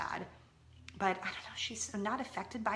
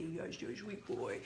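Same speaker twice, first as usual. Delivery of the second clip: cooing to the dog; 3 semitones lower, 9 dB quieter, slower than usual. Real, not acted. Soft talk to the dog.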